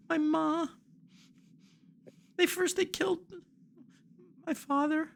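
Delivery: put-on a little boy telling how his family was killed; high, broken child voice recounting the raid; grieving young boy.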